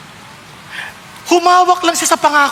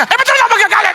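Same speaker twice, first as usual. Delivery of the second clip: defensive laughing protest; 9 semitones higher than usual. Retold or imitated. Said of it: "sabi ko sa asawa ko".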